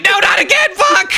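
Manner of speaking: very high wailing yelp; hammed-up despairing squeal; mock panic. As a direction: cry out like a kid dreading dad's return. Brother dreads dad coming back.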